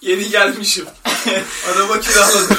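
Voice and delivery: high, loud put-on newcomer voice. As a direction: act out the newly arrived guy. Acting out a newcomer.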